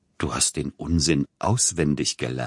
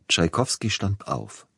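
plain reading voice

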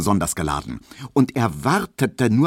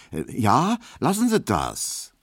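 higher-pitched, mildly miffed objection; offended protest; 6 semitones higher than usual. A narrator's reading. Maid rebuffs her master's insinuation, mildly offended. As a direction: tell him to stop, a touch hurt. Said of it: "nur schwer gekränkt ob des Verdachtes".

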